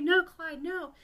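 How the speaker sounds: high, pleading mock anguish; distraught apology. Pinocchio apologises to injured Clyde, high and distraught. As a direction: open loud and high, then soften into sorry pleading.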